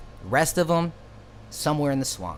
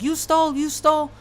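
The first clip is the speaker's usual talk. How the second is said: high, louder mock Indian accent yelling; accusing mock yell; 14 semitones higher, 5 dB louder than usual. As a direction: jab the accusation at him in the clerk's voice, pitched high. Clerk accusing him.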